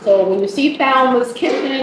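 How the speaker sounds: ordinary talk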